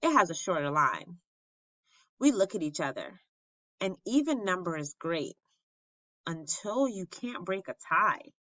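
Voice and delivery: plain reading voice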